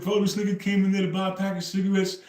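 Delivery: high, loud, amazed quote of himself; hyped, incredulous bro-talk; excited retelling. Retold or imitated. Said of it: "And I said".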